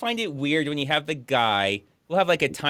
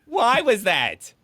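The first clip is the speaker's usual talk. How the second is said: high-pitched, incredulous squawk; baffled outburst; 11 semitones higher, 3 dB louder than usual. Real, not acted. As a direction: demand an explanation in a high, laughing disbelief. Baffled outburst at an included clip.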